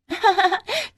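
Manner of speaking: high, dismissive giggle; acted haughty titter; scoffing laugh. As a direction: laugh off the warning. Anju laughs it off.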